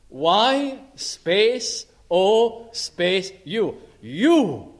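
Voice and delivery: slow, spelling out Y O U; Y space O space U, then a punched You; spelled-out emphasis. Shown as a demonstration. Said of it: "Just like that".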